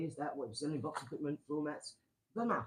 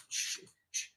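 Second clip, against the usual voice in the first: shushing. Real, not acted. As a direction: hush them quickly, breath only. Hushing someone on stream.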